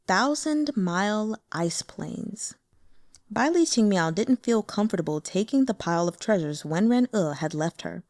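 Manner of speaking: ordinary delivery, nothing marked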